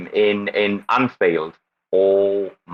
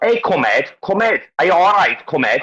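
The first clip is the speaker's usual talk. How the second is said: higher-pitched Scouse catchphrases; Scouse accent; 7 semitones higher than usual. Shown as a demonstration. Showing friends how Scouse sounds, raised put-on Scouse accent. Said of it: "I bet Fraser's got a better one".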